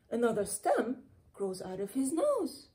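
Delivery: ordinary delivery, nothing marked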